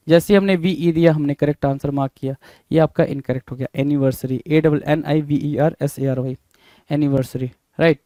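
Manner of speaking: ordinary talk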